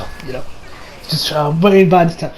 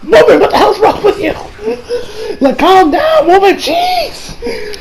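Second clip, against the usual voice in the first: exasperated yell. Real, not acted. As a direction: shout at her in disbelief, half laughing. Reacting to overdramatic wife.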